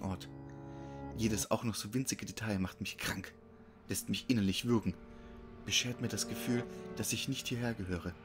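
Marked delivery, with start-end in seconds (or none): none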